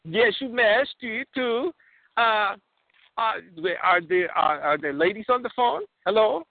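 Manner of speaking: put-on eager Borat, Kazakh accent. Borat asks the hosts about ladies, Kazakh accent. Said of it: "my name is, uh, Borat".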